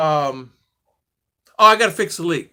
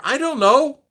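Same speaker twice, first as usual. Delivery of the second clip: higher, louder flustered reply; flustered retort; 9 semitones higher, 5 dB louder, slower than usual. Retold or imitated. Caller to 911 about a stolen truck, flustered. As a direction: blurt it back at the dispatcher, pitched up.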